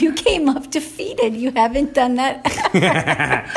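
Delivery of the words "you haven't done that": laughing. Real, not acted. Teasing partner during story.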